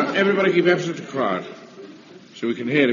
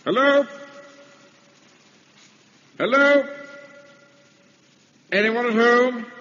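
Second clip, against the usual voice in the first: calling out; 11 semitones higher, slower than usual. Acted. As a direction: call out to an empty house. Calling into empty house.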